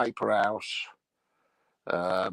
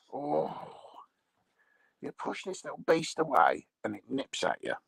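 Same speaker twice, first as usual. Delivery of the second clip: ordinary talk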